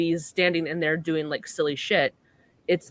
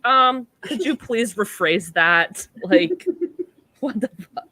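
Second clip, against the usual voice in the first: slow, louder, affronted ask; offended AI; 4 semitones higher, 5 dB louder than usual. Retold or imitated. Ergo affronted at being called impotent, slow. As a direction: react scandalized to the word. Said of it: "he's like".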